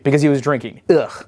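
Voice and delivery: usual speaking voice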